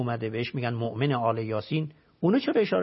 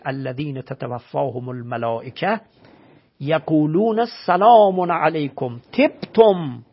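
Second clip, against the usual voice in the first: slow, louder chanted Arabic verse; Quran recitation; 5 dB louder, slower than usual. Acted. Teacher reciting the verse about angels greeting the righteous, slow and chanted. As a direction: intone it slowly, swelling on سلام.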